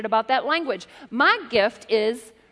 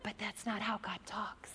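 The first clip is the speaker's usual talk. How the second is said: whisper; 15 dB quieter than usual. Shown as a demonstration. Contrasting booming with gentle.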